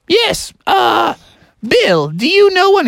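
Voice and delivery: high, impatient teacher goose; Mother Goose. Acted. Teacher moves on to the next pupil, high impatient goose. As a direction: call on the class in falsetto. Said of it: "She's like".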